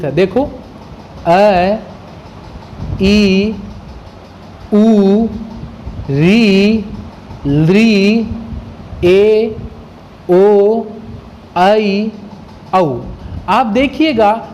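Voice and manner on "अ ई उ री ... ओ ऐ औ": अ, ई, उ, री, लृ, ए, ओ, ऐ, औ sounded one by one with an open mouth; teacher demonstrating अ, ई, उ, री, लृ, ए, ओ, ऐ, औ, each drawn out separately and open-mouthed; vowel recitation. Shown as a demonstration. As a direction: recite "अ, ई, उ, री, लृ, ए, ओ, ऐ, औ" as long, evenly held vowels with clear pauses between. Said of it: "अच प्रत्याहार बोलता हूं".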